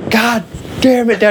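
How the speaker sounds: high-pitched mock outburst at dad; pained curse. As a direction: yelp it out like you just took a ball to the face. Hit in nose, cursing at dad.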